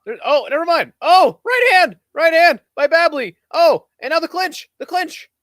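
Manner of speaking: high, loud, excited play-by-play; excited fight call. Real, not acted. Fight commentator reacting to a flurry of right hands, excited.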